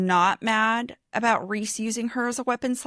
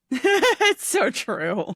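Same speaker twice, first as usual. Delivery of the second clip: laughing; 5 semitones higher, 4 dB louder, much slower than usual. Real, not acted. Laughing agreement.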